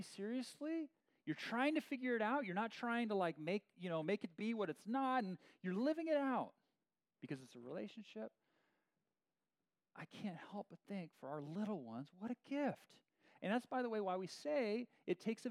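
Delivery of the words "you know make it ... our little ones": usual speaking voice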